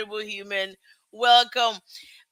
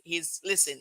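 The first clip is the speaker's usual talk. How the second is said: ordinary talk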